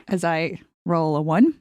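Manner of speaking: usual speaking voice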